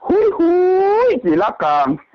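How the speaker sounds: high ringing call of hui hui. Acted. Calling to someone far away, high ringing call. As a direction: sing out the hui like calling across fields.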